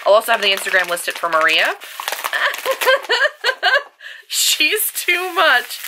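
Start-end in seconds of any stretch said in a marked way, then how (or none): 1.8-3.8 high squealing laugh. Real, not acted. Vlogger opening a gift from Melanie, high squealing laugh. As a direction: burst into a high giddy laugh.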